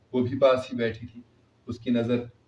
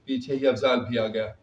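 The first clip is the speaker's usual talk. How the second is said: usual speaking voice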